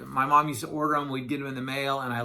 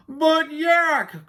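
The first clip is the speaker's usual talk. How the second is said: high, loud wail; crying-out Walrus; 12 semitones higher, 5 dB louder than usual. A narrator's reading. Walrus trying to break into Yak's monologue, crying out. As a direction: cry out in exasperated pleading. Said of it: "cried Walrus".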